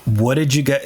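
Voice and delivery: ordinary talk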